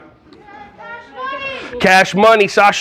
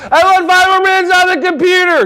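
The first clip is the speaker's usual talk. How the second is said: put-on high whining child voice; whiny kid; 10 semitones higher than usual. Kid begging teacher for computer time, high whine. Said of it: "One of the kid comes up".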